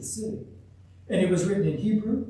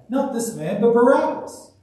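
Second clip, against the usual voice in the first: loud crowd shout, rising higher; shouted demand, pitched up; shouted reply; 7 semitones higher, 8 dB louder than usual. A narrator's reading. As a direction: shout it back, building to the name. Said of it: "They shouted in reply".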